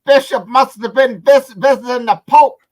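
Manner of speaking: excited sports announcer's call, high and loud; announcer impression. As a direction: yelp it out like a wildly excited game commentator. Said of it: "He would have said".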